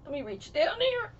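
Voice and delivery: ordinary talk, nothing marked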